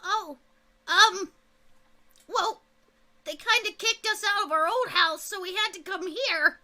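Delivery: hint of a high goblin voice; lightly voiced goblin. Goblin explains, then directions.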